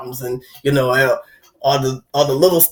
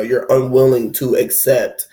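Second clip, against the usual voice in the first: normal talking voice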